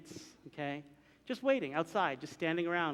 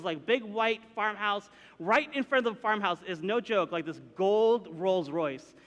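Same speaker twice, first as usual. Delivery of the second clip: ordinary delivery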